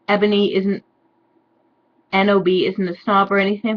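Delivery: normal talking voice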